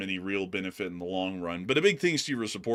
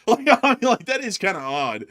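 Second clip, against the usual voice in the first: laughing through the words, higher; giggling it out; 9 semitones higher, 7 dB louder than usual. Real, not acted. Host laughing while telling co-host it was odd.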